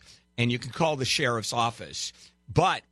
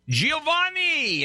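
drawn-out call; 4 dB louder, much slower than usual. Real, not acted. Host greeting caller dramatically.

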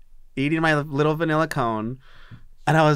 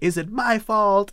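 mock crying; 7 semitones higher than usual. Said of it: "I started doing, like, the cry thing".

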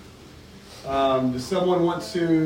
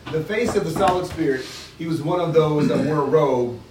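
reading a novel aloud to the group; steady read-aloud with the characters lightly voiced; story reading; 3 semitones higher than usual. To the book group, reading the chapter aloud.

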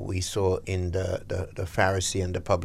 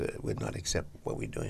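whispered aside; 5 semitones lower, 10 dB quieter than usual. Real, not acted. Joke aside about the doctor.

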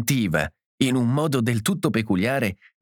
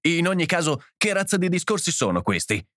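higher-pitched, indignant question; brusque challenge; 7 semitones higher than usual. A narrator's reading. John challenging the host's talk, brusque. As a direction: demand to know, pitch raised. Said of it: "domandò John bruscamente".

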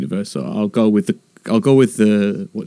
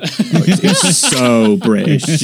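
stretched so mockingly; 4 semitones higher, much slower than usual. Shown as a demonstration. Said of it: "when you extend that so".

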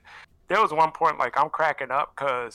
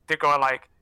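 ordinary talk

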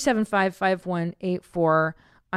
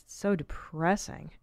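ordinary talk

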